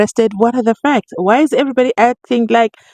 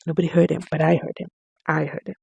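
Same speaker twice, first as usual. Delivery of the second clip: hushed; 3 semitones lower, 9 dB quieter, faster than usual. Real, not acted. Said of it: "But nobody heard him".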